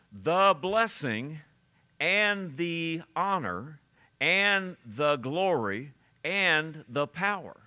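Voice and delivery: deliberate, weighty phrases, slow and set apart; slow, emphatic reading. Shown as a demonstration. Preacher reading a verse's praise list to the congregation, slow and emphatic. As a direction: read it slowly, each item standing alone. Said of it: "it slows you down".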